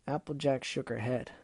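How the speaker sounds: usual speaking voice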